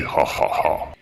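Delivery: gloating laugh. Acted. Neighbour gloating.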